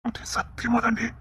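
soft unvoiced whisper of a vow; hushed, pleading whisper. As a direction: whisper it close to her ear. Husband coaxing wife at night.

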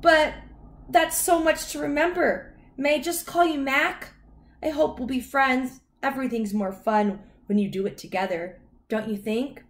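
high-pitched, chattering dog voice; Lucky the dog. A narrator's reading. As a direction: prattle on as a friendly dog.

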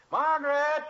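loud, high call across the house. Acted. Father calling his wife from the kitchen, loud high call. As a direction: yell for her from the other room.

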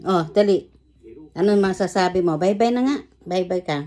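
ordinary talk

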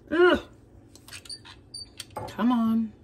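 usual speaking voice

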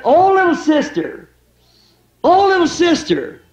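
put-on high, slow child's voice calling out; little boy calling. Little brother calls out to his scared sister in the dark, high and slow.

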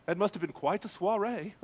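mock-refined British narrator; put-on British politeness, like Obi-Wan Kenobi; refined British accent. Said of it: "narrating in some refined British accent".

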